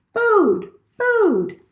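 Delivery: a starving chick squeaking for food; hungry gosling. A narrator's reading. Hungry hatchling demands food.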